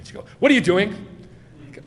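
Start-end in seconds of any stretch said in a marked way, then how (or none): 0.4-0.9 loud, higher mock scolding; imitated accusing wife. Wife catching husband glancing at another woman, imitated accusation. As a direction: pounce on him, imitating a jealous wife. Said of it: "she'd catch me".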